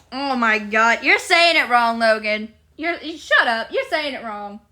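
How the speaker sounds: ordinary talk, nothing marked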